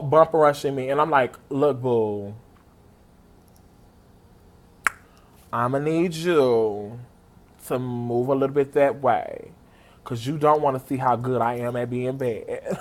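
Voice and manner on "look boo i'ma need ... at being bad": low, slow, measured threat; quiet, deliberate words with long pauses; restrained warning. Retold or imitated. Fan warns the dude bum-rushing her, low controlled menace. Mock warning to pushy concertgoer.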